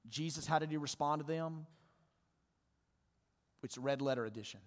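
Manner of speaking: ordinary talk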